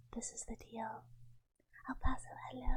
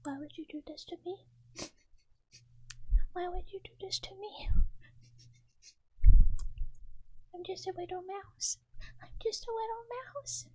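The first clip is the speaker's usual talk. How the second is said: put-on higher, babyish poor-little-me voice; mock pitiful; 8 semitones higher, 10 dB louder than usual. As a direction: plead like a tiny wounded mouse, hammed-up. Plays pitiful little mouse, pretend crying.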